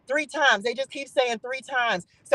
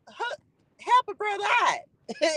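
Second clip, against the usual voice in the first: high, slow, pleading plea to God; lightly voiced prophet pleading with God; high pleading prayer; 9 semitones higher than usual. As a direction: beg God for a favour, voice climbing high. Elijah praying over dead boy.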